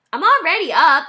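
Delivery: put-on high, loud kid's retort; a boy pleased with himself; cheeky child. A narrator's reading. Child answers mother.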